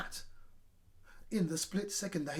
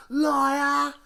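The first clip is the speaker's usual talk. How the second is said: high, loud shout; shouted accusation; 8 semitones higher, 12 dB louder than usual. A narrator's reading. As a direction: hurl it back at her. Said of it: "shouted horrid Henry".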